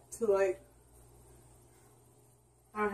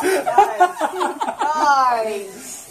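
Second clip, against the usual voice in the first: calling out; 6 semitones higher, 5 dB louder, much slower than usual. Real, not acted. Calling friends repeatedly.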